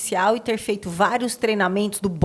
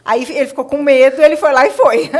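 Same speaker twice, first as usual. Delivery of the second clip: laughing louder through the punchline; can't hold back the laugh as the story lands; 4 semitones higher, 9 dB louder than usual. Real, not acted. Therapist laughing at her own story to the hosts, laughing.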